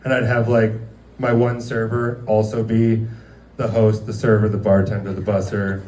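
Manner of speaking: ordinary talk, nothing marked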